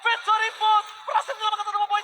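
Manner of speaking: hyped esports play-by-play shout; fighting game announcer. Acted. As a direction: yell the big steal call. Live esports casting climax.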